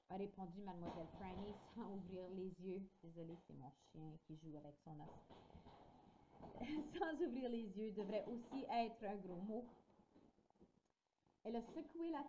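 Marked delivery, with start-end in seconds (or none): 5.1-6.9 a quick airy laugh, caught off guard; breathy laugh. Real, not acted. Reader laughing at her dog chewing a bone, a short breathy laugh.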